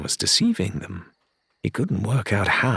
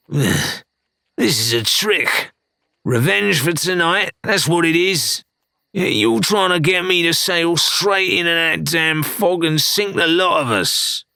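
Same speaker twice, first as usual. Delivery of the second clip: much higher, louder suspicious outburst; agitated pirate; 9 semitones higher, 3 dB louder than usual. Acted. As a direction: panicky accusing rant. Jim accuses, agitated.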